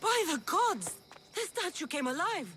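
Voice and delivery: high startled cry; high, shocked cry at a living statue; shocked exclamation. Acted. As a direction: gasp it out as the stone moves. Statue suddenly speaks.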